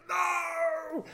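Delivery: very high, drawn-out wail; panicked cry. Retold or imitated. Storyteller suddenly losing it to the hornets, high panicked cry. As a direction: lose control in one high cry.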